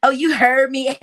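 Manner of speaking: high mocking imitation. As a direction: mimic her with a laugh in reach. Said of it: "this shit is so cute".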